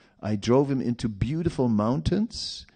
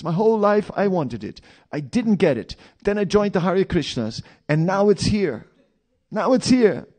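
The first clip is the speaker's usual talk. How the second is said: high, loud, frantic inner voice; mock desperate craving; 10 semitones higher, 7 dB louder than usual. Devotee torn between vows and temptation, high loud hammed-up anguish. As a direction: ham up the tempted devotee's panic.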